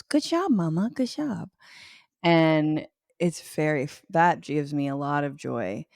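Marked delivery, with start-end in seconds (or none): 0.0-1.5 put-on high sing-out praise in a toddler's voice; imitating toddler. Mom quotes her potty-trained toddler praising her, in toddler voice. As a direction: play a tiny child cheering mama on. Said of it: "she goes".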